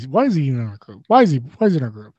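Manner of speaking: usual speaking voice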